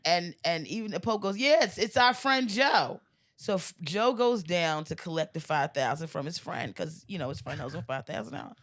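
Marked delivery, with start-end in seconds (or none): 1.4-3.0 high, slow, drawn-out proclamation; stretched-out, pitched-up announcement; proclaiming. Acted. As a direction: declare it grandly to the congregation. Pope confirms Joe to the crowd.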